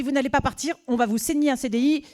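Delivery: high, loud insisting; insistent boss. Retold or imitated. Boss insisting the temp stay with a contract. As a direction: refuse to let her go.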